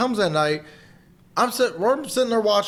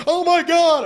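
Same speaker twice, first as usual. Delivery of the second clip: loud, high startled cry; mock a sudden jump-scare reaction; jump-scare shriek; 13 semitones higher, 6 dB louder than usual. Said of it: "That's exactly what a jump scare is".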